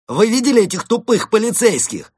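high, loud provocation; as loud as possible. A narrator's reading. Captive Константин baiting police before his guards, very loud. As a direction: project it so everyone around hears. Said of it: "как можно громче сказал он".

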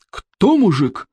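restrained roar. A narrator's reading. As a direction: roar out the challenge without much volume. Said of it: "взревел вождь берсерков".

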